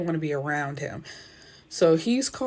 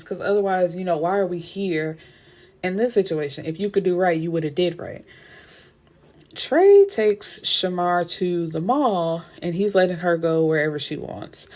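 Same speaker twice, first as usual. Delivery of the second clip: normal talking voice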